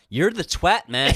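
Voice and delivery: put-on American accent. Co-host requests Todd Boehly in the accent, mock American. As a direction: do a rough American impression. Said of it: "Can you do it in the accent, please?".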